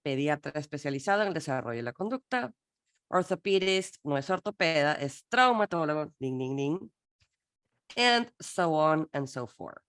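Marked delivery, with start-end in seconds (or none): none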